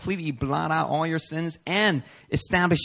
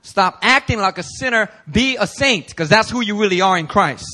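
louder, higher command to the church; exhorting loud; 4 semitones higher, 6 dB louder than usual. Real, not acted. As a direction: urge them hard, like a charge. Preacher exhorting the congregation.

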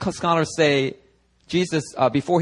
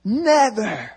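high-pitched dramatic denial; acted vehement refusal; 7 semitones higher, much slower than usual. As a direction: protest it high, as a hammed-up Peter. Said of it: "And Peter's like".

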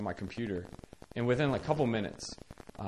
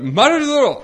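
high, very loud Korean command; retold shouted demand to be heard; infuriated yell; 14 semitones higher, 14 dB louder than usual. Caretaker yelling a newly learned Korean phrase, infuriated. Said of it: "I'm infuriated".

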